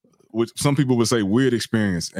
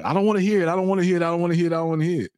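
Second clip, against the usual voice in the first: dismissive refusal; 7 semitones higher, much faster than usual. Retold or imitated. Mocking someone refusing to listen.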